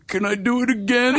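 small, high-pitched pleading voice; hammed-up meek begging; pathetic plea. Failed actor asks the casting room for another try, mock pathetic plea. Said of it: "I'm standing just pathetic".

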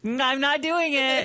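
playful high refusal; tease, refusing through giggles; giggly late night call. Real, not acted.

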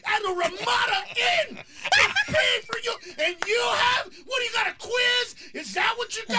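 high, loud furious mock rant; exasperated dad yelling at his anxious son; snapping, yelling dad. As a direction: scream it at your kid in the car. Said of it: "And I just snapped".

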